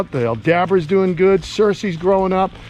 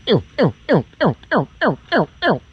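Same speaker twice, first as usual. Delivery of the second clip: quick high falling "ew" chirps; short chirped calls; 4 semitones higher than usual. Shown as a demonstration. Man calling to his gators, high clipped chirps. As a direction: chirp it in short, evenly repeated bursts.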